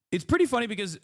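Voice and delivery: "pretty funny" lifted high and bright. Shown as a demonstration.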